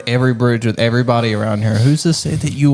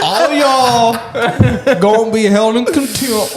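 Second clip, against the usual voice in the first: high, laughing put-on judge voice; mock judge; 8 semitones higher, slower than usual. Friend mocks a judge scolding the whole room, giggling.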